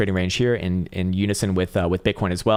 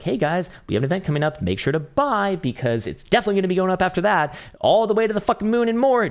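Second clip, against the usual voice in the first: high, climbing salesman-like pitch; imitating an over-eager promoter; mock hype voice; 10 semitones higher than usual. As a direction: hype it up sarcastically, rising higher to the end. Mimics the market hyping an event.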